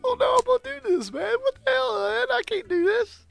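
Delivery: high-pitched mock crying; retold crying. Friend imitates a crying skydiver, tearful high whine. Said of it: "He was crying, dude".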